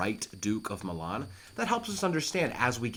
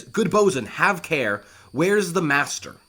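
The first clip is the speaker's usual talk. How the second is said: calling out; 5 semitones higher, 7 dB louder, slower than usual. Acted. King calls to bosun.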